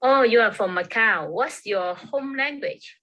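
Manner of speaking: ordinary talk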